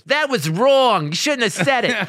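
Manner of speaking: high, loud reproachful heckle; scolding lady. Retold or imitated. Lady in the back scolding the comic, high loud reproach. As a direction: call it out slowly, scandalized. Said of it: "this lady goes".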